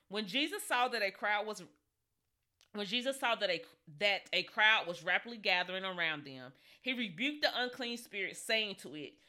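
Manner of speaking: ordinary talk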